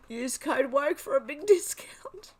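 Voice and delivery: high, slow, struggling not to laugh; laughing re-read. Real, not acted. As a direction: read it out while cracking up. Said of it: "I'm laughing so much".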